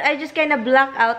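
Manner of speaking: normal talking voice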